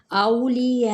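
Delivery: औलिया recited with the औ gliding; recited word. Shown as a demonstration. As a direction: sing out औलिया in tajweed. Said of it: "अब औ पे क्या है".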